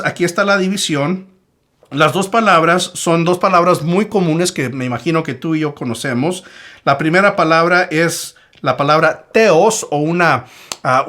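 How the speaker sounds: usual speaking voice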